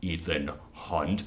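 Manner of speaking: deep, low, soft mock-American growl; low muttered fake American accent. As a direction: impersonate Cavill straining at an American accent. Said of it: "He can't do American accents".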